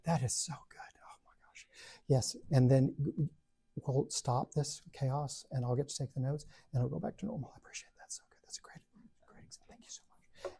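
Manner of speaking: hushed, grateful whisper; relieved student whispering gratitude. Retold or imitated. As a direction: whisper your thanks in relief.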